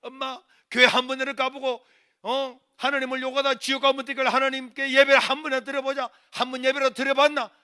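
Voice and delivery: high, loud, insistent urging; impassioned pleading. Retold or imitated. Son carrying mother to church, urgent loud pleading. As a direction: implore her at full voice.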